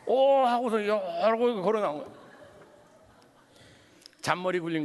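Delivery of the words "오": mock scared oh. Son imitated padding up, scared.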